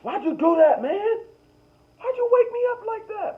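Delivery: high, loud complaining voice; high-pitched groggy complaint; upset sleeper. Acted. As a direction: whine at the roommate who woke you.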